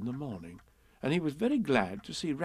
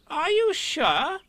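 much higher, slow surprised question; 5 dB louder, much slower than usual. Acted. Rabbit doubts it's Pooh, surprised. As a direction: act amazed. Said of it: "still more surprised".

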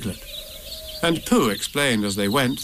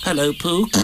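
higher, small-animal greeting; Piglet; 5 semitones higher than usual. A narrator's reading. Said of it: "said Piglet".

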